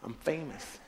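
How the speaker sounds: slow, hushed bragging; soft show-off. Retold or imitated. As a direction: savor it quietly, slowly. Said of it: "We would love to be like".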